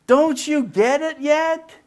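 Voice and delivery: exasperated God, pitched high. Retold or imitated. God in heaven to us, exasperated and pleading. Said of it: "God's just sitting there in heaven going".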